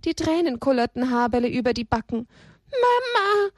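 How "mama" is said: high tearful sob on Mama; sobbing Mama. Acted. Little donkey sobbing for his mother, high tearful cry. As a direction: cry it out through tears. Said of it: "schluchzte er noch einmal".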